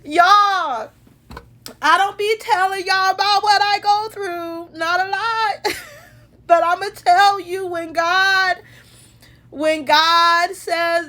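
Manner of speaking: high, tear-filled voice; crying gratefully. Real, not acted. Woman confides in viewers about what she goes through, tearfully. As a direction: testify earnestly through real tears. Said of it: "I'm crying because God is good".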